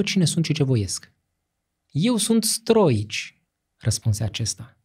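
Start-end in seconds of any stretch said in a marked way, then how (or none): 1.9-3.4 slow, higher menacing reveal; grim boyar, slow and high; slow grim announcement. A narrator's reading. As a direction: reveal yourself, grim and deliberate. Boyar confronts the dying ruler.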